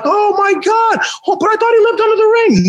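put-on high, fast kid voice; excited kid voice. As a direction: gush like a surprised kid. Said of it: "all the kids would have been like".

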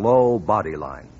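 ordinary talk

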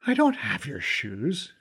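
lightly voiced Fergal, baffled; confused. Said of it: "he said, confused".

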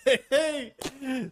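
high, laughing mock celebration; pleased with himself, laughing it off; laughing hey. Answering the class's praise, high laughing mock triumph. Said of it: "I was like".

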